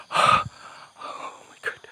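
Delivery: slow, gasped-out whisper; breathless awe. Real, not acted. Ghost hunter overwhelmed by the encounter, breathless whisper. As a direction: let it out on heavy breath.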